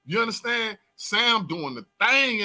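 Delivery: ordinary delivery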